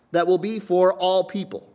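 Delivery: high, loud announcement; proclaiming scripture. Acted. Pastor reads the angel's words, loud and high. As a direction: ring it out like a herald.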